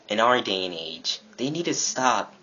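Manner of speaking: normal talking voice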